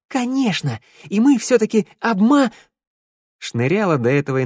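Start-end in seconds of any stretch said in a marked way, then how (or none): 0.1-2.5 high, exultant cry; joyful. A narrator's reading. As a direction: cheer the idea, breaking off mid-word. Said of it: "радостно вскинула кулак". (радостно, с восторгом)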